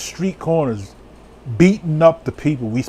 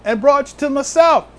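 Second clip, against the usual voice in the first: raised, emphatic preacher's voice; forceful preaching; 7 semitones higher, 3 dB louder than usual. Real, not acted. Preacher telling congregation who God was addressing, forceful preaching. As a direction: press each point home from the pulpit.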